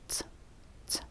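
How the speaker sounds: Ts as a single fused t-plus-s, twice; ts as one sound. Shown as a demonstration. Teacher showing t and s as one sound, crisp isolated Ts. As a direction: fire off Ts as one consonant, pausing between the two. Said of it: "t, and s as one sound".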